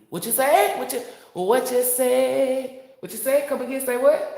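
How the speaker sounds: high, loud incredulous question; mock disbelief. Speaker answers the creator's question, high and loud disbelief.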